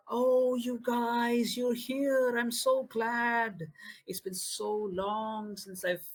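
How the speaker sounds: put-on high, slow, sweet childish voice; sugary man-child greeting; cheery childlike man. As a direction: gush like a happy child. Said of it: "completely changes into a very pleasant smile".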